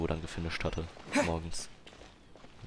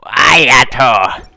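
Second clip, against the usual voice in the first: explosive, drawn-out cry of the name; sudden loud shout; 5 semitones higher, 19 dB louder than usual. Real, not acted. Player blurts out a game character's name, shouting. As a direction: yell the name out, much louder and higher.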